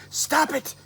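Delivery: very high, urgent cry to stop; sharp hush. Acted. Sarah frantically stopping Hannibal, high and sharp. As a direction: cut him off before someone hears.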